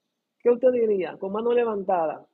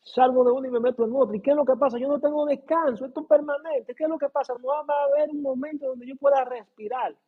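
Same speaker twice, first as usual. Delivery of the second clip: higher-pitched, exasperated complaining voice; weary believer's complaint; 7 semitones higher than usual. Retold or imitated. Believer complaining to the congregation about constant trials, higher exasperated voice. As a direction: play a tired believer fed up with endless trials. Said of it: "Y usted dice".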